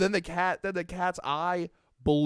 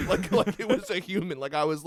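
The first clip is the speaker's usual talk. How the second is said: usual speaking voice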